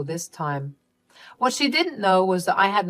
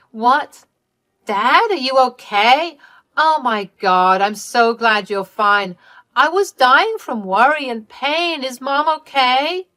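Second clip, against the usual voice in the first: higher, louder, slowed-down gushing relief; frantic relief; 6 semitones higher, 6 dB louder, slower than usual. Acted. Daughter gushing fake relief at her alive parents, higher and louder. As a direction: overdo the relief to sound convincing.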